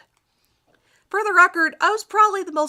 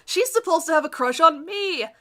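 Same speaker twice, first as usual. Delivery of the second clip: usual speaking voice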